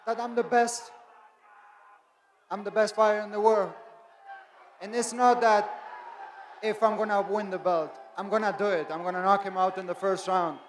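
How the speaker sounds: loud cocky fighter bragging; cocky breathless boxer boasts. Real, not acted. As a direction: brag loudly, sure of the win. Fighter boasting at press conference.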